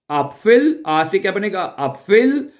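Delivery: एप्पल said as "apfel" with an umlaut a; एप्पल rising with a pf sound; German Äpfel. Shown as a demonstration. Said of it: "आ umlaut से बनेगा एप्पल". ("एप्फ़ल" उमलाउट के साथ)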